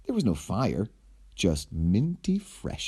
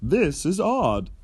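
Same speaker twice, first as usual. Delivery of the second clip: higher, slow hero voice; hero puzzled; 8 semitones higher, 3 dB louder, slower than usual. Acted. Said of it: "said the hero".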